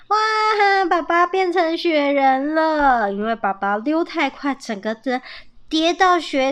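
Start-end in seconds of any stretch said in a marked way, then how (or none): 0.1-3.1 gleeful. A narrator's reading. Young squirrel finding dad turned into a snowman, gleeful. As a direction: exclaim with glee, stretching the words. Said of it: "波波笑着跑过来说".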